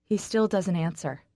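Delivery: usual speaking voice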